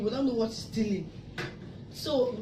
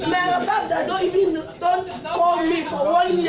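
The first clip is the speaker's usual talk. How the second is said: loud, high, laughing testimony shout; joyful church shout, laughter breaking through; ecstatic shouted praise; 7 semitones higher, 8 dB louder than usual. Real, not acted.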